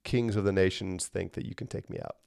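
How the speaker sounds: God lightly mocking kings; mild amused mockery. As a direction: gently laugh at them as cute. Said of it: "He's mocking".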